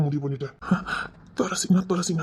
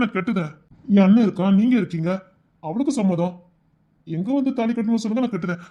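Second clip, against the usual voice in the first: ordinary talk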